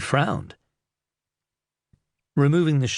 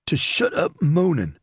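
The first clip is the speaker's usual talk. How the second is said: higher, forceful interrupting snarl; angry growl; 6 semitones higher, 3 dB louder than usual. A narrator's reading. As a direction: cut the whiner off angrily. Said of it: "growled Wakelin".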